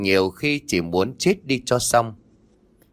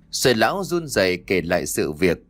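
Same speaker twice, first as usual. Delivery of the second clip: plain reading voice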